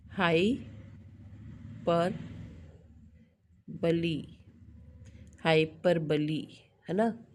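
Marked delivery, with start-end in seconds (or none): 0.1-4.4 हाई, पर, बली in separate pieces with long pauses; syllable by syllable. Shown as a demonstration. Teacher transcribes for students, हाई पर बली broken up by long gaps. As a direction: dictate हाई पर बली part by part, slowly. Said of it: "हम लिखते हैं".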